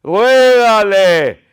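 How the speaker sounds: high, slow, stretched-out cry; fervent urging. Real, not acted. Preacher urging believer to plead with God, fervent urging. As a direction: draw each command out forcefully.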